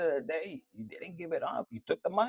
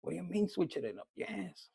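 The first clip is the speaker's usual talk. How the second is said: usual speaking voice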